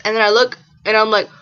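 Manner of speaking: ordinary delivery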